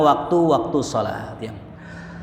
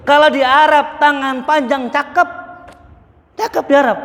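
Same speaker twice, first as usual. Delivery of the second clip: excited emphasis; 9 semitones higher, 3 dB louder than usual. Real, not acted. Teacher springing the joke on the congregation, high and loud. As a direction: sell the twist with a lifted, ringing voice.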